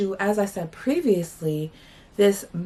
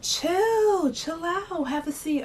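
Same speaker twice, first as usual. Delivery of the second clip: very high, softening mocking drawl; mimicking the guy telling her to chill; mock calm guy; 10 semitones higher, much slower than usual. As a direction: imitate him brushing her off, high and sing-song. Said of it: "He's like".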